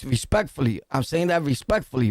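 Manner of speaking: normal talking voice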